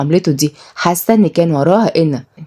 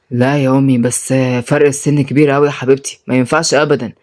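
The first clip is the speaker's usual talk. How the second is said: reluctant son; 6 semitones lower than usual. Acted. Son refusing mother's marriage idea, reluctant. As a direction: decline in a lowered voice.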